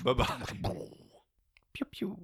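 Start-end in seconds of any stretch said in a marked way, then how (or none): 1.7-2.2 whispered laser sound. Acted. Closing sound effect.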